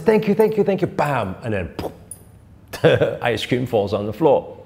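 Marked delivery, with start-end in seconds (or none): 0.0-0.9 put-on high, happy kid's thank-you; excited kid. Kid thanking his dad, lightly voiced excitement. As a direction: bounce it like a thrilled child. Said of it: "He's so excited".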